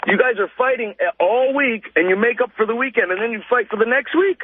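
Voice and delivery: usual speaking voice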